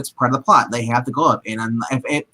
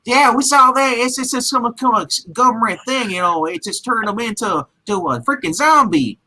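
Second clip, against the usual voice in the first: mock militia guy; 8 semitones higher than usual. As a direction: imitate the militia guy brushing it off as a government thing. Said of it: "He's like".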